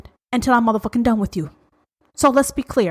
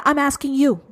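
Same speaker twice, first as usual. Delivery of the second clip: ordinary talk, nothing marked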